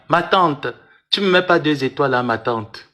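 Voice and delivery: ordinary delivery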